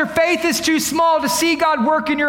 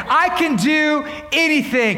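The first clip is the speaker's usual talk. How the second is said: usual speaking voice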